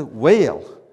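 loud Southern "whale" with two syllables, falling; big Southern drawl on whale; Southern accent. Shown as a demonstration. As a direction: stretch the word into a Southern drawl. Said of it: "Which is better to say".

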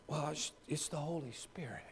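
whispered, embarrassed admission; a hushed, awkward whisper; sheepish whisper. Retold or imitated. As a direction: breathe it out like a guilty confession. Joseph's sheepish answer, whispered.

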